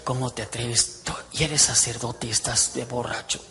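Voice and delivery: low, hushed scolding; mild mock reproach. Priest reproves his tipsy colleague, low mock-indignant scolding.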